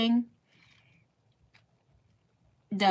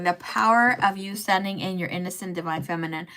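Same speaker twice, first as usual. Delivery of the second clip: ordinary delivery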